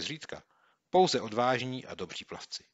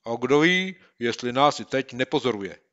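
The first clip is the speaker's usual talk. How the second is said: louder and higher, wary; suspicious boy; 4 semitones higher, 10 dB louder than usual. Acted. Boy to his friend, wary and suspicious. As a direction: suspect someone watching.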